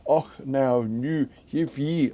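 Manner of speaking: mock phonetic Scottish accent. Shown as a demonstration. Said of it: "a kind of phonetic Scottish accent".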